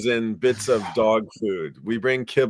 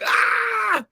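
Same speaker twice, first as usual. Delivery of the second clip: startled cry. Retold or imitated. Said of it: "they were like".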